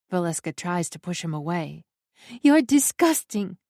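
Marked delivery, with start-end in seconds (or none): 2.4-3.6 loud, high and revolted; disgusted shout. A narrator's reading. Valeska pushes off her brother, angry loud cry. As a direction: spit it at him. Valeska pushing him away.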